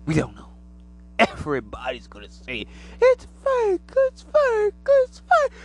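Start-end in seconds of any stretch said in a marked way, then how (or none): 3.0-5.5 very high, loud mocking squeal; mocking doubters. Mocking the people who say aliens are fake, very high and loud. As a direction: mimic the sceptics in a shrill taunt.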